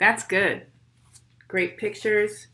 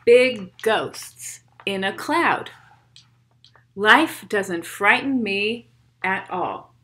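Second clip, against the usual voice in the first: put-on steady rhythmic poem read for kids; 5 dB louder than usual. Reads poem aloud to children.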